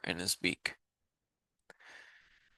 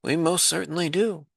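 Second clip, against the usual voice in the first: much higher piping reply; piped; 7 semitones higher than usual. Acted. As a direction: pipe it like a tiny mayor. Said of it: "piped the voice".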